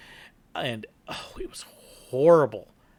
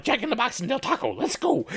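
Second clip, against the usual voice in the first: eager; 9 semitones higher than usual. Retold or imitated. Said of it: "I'm like".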